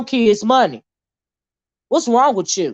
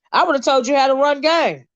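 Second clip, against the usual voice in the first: ordinary talk, nothing marked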